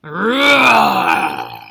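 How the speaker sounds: loud booming roar; huge bear roar; great bear roar. A narrator's reading. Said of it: "let out a great roar".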